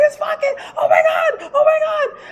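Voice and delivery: very high, squealed panic; panicked mock screaming. Storyteller acting out her fright to viewers, screaming. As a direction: shriek it in a reenacted panic. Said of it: "And I'm screaming".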